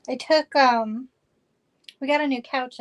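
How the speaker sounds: ordinary talk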